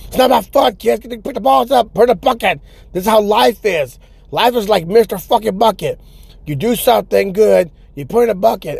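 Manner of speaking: high, strained mock life lecture; ranting hardcore grandpa. Guitar guy teaching kids about life, hammed-up rant. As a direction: preach hard truths in a worked-up high voice.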